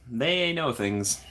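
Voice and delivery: slow, deliberate "they know things"; impressed and knowing. Retold or imitated. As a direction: draw it out knowingly. Quoting her own reaction.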